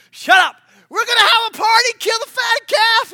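high, loud jubilant interruption; joyful shout. Retold or imitated. Dad interrupting son's apology, jubilant shouting. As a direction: cut him off with a happy shout.

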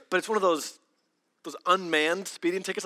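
ordinary talk

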